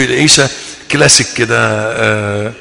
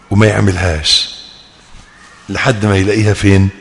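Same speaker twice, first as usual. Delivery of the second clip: ordinary talk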